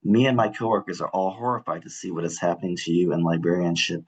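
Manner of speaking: normal talking voice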